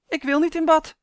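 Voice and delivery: put-on high, loud child's refusal; a small boy digging in his heels; little Henrik refusing the bath, high and loud; stubborn little boy. A narrator's reading.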